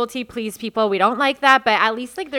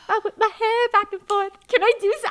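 high-pitched gushing fangirl; mock fangirl squeal over the star; 9 semitones higher than usual. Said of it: "like, fangirl o".

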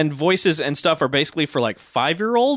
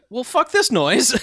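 mock exasperation; 8 semitones higher, slower than usual. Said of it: "And then the club's like".

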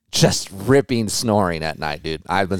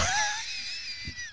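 high shrieking laugh; 14 dB quieter than usual. Real, not acted. Laughs at wife waking up.

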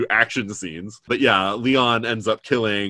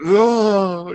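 high trembling oh; a mock quiver on one word; 9 semitones higher than usual. Shown as a demonstration. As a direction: let the oh wobble. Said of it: "voice where you're quivering".

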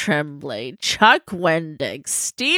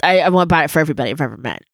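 ordinary talk

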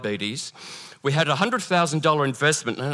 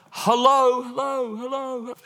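calling out; 10 semitones higher, slower than usual. Acted. Believer calls for God in a storm, calling out. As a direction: call into the void, each hello fading.

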